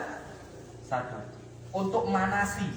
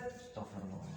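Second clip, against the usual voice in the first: tiny low mumble; muttered dhikr; 12 semitones lower, 18 dB quieter than usual. Acted. Companions ducking Amr's challenge, muttering very softly. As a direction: mumble it, barely audible.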